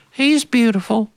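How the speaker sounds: high, far-off doting voice; doting mother. Retold or imitated. Mother answering her sisters, high and doting. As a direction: sigh it out like a besotted mother. Mother dotes on son's curls.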